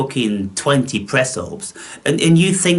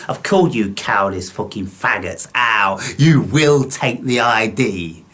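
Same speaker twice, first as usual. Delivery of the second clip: normal talking voice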